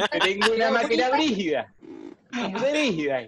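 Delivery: high-pitched mock saleswoman with a laugh in it; imitating the wife. Teasing a friend about his wife selling machines, high mock impression. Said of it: "por eso es que ella habla así".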